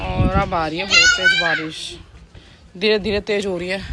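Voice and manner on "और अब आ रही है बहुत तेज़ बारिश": excited. Real, not acted. Excited about heavy rain.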